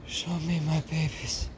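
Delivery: high, dramatic French mother mouse. Acted. New mother after birth, asking for her babies, high and dramatic. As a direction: play the weary French mother, high and theatrical.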